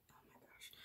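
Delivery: unvoiced exasperated whisper; whispered exasperation mid-task. Real, not acted. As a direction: breathe it out under your breath. Whispered frustration doing makeup.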